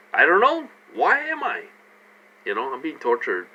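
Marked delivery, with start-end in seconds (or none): 0.1-1.6 high-pitched exaggerated cluelessness; put-on, high-voiced puzzled retort; mock bewilderment. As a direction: play baffled, pitch leaping way up. Mock answer to questioners.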